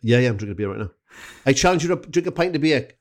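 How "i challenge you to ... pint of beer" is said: high, loud put-on Valleys Welsh accent; Pontypridd-Merthyr accent. Mexican fan challenging him to a pint, in a high Pontypridd-Merthyr accent. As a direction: throw down the challenge in broad Pontypridd. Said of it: "I'll do Miss Pontypridd, uh, slash Merthyr".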